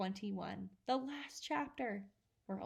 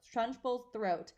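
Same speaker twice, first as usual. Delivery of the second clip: ordinary delivery, nothing marked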